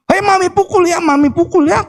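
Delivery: high-pitched imitated maternal threat; mock scolding mother. Mother threatening her child with a smack, mock scolding. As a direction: play a nagging mom, pitched well up. Parent's threat acted out in sermon.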